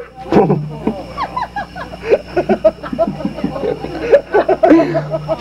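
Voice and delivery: high squeaky puppet laugh; high dummy cackle; dummy giggle. Acted. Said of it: "here's my ventriloquist act".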